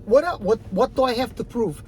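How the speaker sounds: normal talking voice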